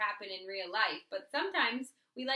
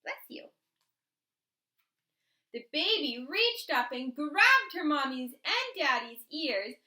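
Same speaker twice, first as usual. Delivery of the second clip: plain reading voice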